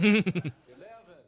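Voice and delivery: slow, drawn-out "Eleven" that comes out Irish; a hammed-up, very loud fake American "Eleven" that slips into Irish, laughing; laughing, overdone American accent gone Irish; failed American accent. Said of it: "That sounds Irish, not American".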